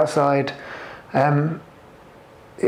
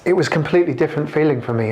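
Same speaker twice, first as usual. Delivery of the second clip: usual speaking voice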